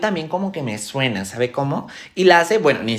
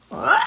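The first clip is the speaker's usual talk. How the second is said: short cry of exasperation; frustrated scream; 11 semitones higher than usual. Acted. Student fed up with her sandwich, frustrated scream. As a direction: let out a stressed shriek.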